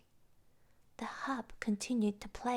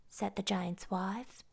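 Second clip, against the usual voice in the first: usual speaking voice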